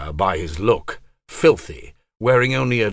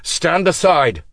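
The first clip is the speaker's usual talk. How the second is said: commanding; 8 semitones higher, 5 dB louder than usual. A narrator's reading. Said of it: "Pug ordered".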